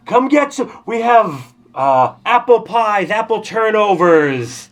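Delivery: loud, high hawker's cry; vendor calling out. Acted. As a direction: sell pies to the whole crowd. Food vendors hawking at festival.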